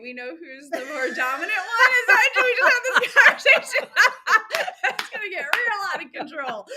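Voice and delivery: talking through real bursts of laughter, high and loud; can barely get the words out for laughing. Real, not acted. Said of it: "you all laughing?".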